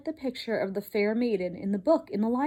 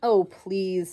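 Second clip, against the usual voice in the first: exasperated wife; 3 semitones lower, 3 dB louder than usual. Acted. Wife rolls eyes.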